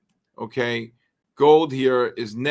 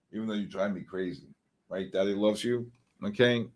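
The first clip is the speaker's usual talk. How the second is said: tender to dog; 4 semitones lower, 7 dB quieter than usual. Real, not acted. Tender aside to his dog.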